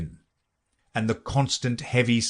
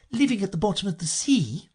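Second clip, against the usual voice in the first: Alice; 10 semitones higher than usual. A narrator's reading. Alice doubts sea creatures need washing, high girlish voice. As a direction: reason it out in a high voice.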